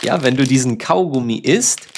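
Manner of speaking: ordinary talk, nothing marked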